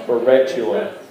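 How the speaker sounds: Perpetua in a put-on country accent; playful country-accent pronunciation. Shown as a demonstration. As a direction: say it like a country speaker. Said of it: "In a country accent".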